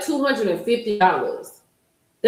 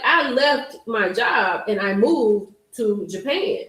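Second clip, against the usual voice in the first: usual speaking voice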